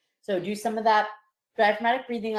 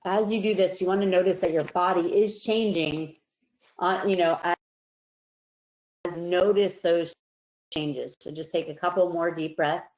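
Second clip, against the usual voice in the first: guided relaxation; 3 semitones lower than usual. Acted. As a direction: ease them into calm with long pauses. Leads a relaxation breathing exercise.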